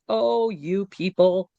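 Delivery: slow, drawn-out mock condescension; lightly voiced older neighbor. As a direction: stretch it out, lightly imitating the neighbor.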